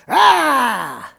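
loud, drawn-out mock attack yell; little boy's battle cry. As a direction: let out a play-fight roar. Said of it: "the little boy will rise up and go".

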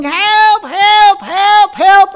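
high, loud mock cries for help; retold screaming. Arrested woman on the news screaming for help. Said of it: "and she's screaming".

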